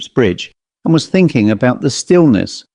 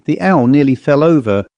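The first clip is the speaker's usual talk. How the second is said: ordinary delivery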